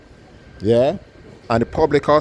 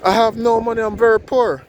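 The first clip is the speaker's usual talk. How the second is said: put-on poor old lady; 10 semitones higher than usual. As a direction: ask him why, protesting she's poor. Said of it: "The lady looked at him and said".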